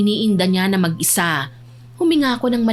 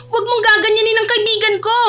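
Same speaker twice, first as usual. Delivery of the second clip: very high, upset younger voice; indignant protest; 13 semitones higher, 3 dB louder than usual. Acted. Abby defends her friend to her cousin, indignant. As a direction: protest hotly in a high pitch.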